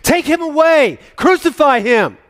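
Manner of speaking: very high and loud, slow on Crucify; crowd shout. Acted. Crowd screaming at Pilate for crucifixion, loud slow yell. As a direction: scream the sentence over the mob. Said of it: "they shouted".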